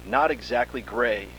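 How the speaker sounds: plain reading voice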